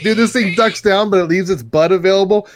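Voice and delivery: telling his buddy, all fired up; excited. Retold or imitated. Excited retelling of chasing rodent.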